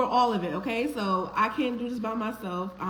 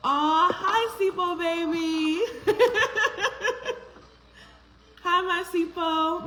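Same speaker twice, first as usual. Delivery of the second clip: high, loud cooing, slowed down; 7 semitones higher, 4 dB louder, slower than usual. Real, not acted. Host cooing at a viewer's baby on camera, high tender coo.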